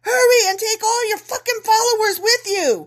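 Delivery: high, loud urgent begging; desperate plea. Acted. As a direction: shout at them to hurry. Officials begging Moses to leave.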